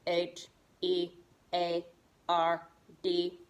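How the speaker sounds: low monotone robot spelling. Shown as a demonstration. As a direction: spell each letter separately, like a robot. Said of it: "spell it in our robot voice".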